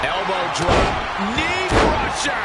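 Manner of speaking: drawn-out high excited call. Acted. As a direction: stretch it out with hype. Live match commentary call.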